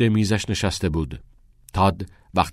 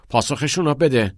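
higher, louder angry command; 8 semitones higher, 5 dB louder than usual. Acted. Father ordering Todd to answer the headmaster, angry and loud. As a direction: bark the order at him. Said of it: "آقای اندرسون با عصبانیت گفت".

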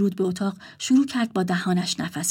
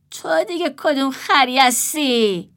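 rude; 5 semitones higher, 3 dB louder, slower than usual. Acted. Rude waking brother.

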